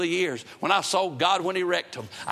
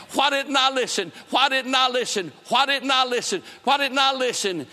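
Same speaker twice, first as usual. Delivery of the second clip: anguished lament; 6 semitones higher than usual. Retold or imitated. Man in the asylum cries out in regret, anguished. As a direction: wail it like a haunted man.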